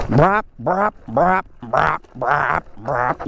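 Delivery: mimicking a dirt bike engine; engine noise. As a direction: brap like a motorbike.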